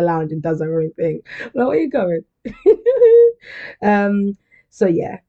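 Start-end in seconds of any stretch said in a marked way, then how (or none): 1.7-2.2 higher, louder needy call; hammed-up possessive boyfriend; playful clingy shout after her; clingy stalker boyfriend. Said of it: "like a stalker boyfriend". 2.4-3.8 high, loud burst of laughter; cracking up hard; shrieking laugh at herself. Real, not acted. Laughing at own impression.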